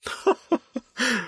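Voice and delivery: short high-pitched laugh, soft; high airy laugh. Acted. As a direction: laugh up high behind a hand. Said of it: "Нина округлила глаза и засмеялась".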